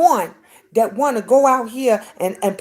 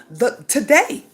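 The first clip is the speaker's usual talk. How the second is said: usual speaking voice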